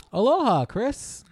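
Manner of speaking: bright, high, sing-song hello; cheery boss greeting. Acted. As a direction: greet the new guy with big enthusiasm. Boss greets new hire, role-play.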